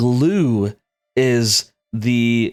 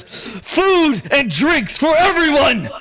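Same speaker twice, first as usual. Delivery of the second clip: chief yelling; 11 semitones higher, slower than usual. Acted. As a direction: call it out to the whole camp. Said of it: "As Mufasa yells".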